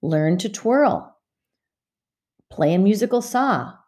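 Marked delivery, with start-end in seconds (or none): none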